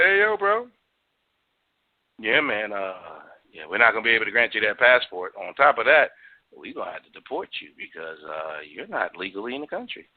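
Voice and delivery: a hint of a bored official brushing him off; casual DHS officer. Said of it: "They said".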